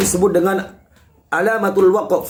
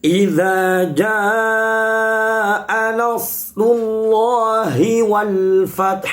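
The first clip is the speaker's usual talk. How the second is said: slow, drawn-out chanted Quran recitation; 3 semitones higher, much slower than usual. Shown as a demonstration. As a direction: recite the verse slowly, melodically. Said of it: "Saya ulangi dari ayat pertama". (tilawah Al-Quran yang lambat dan berirama)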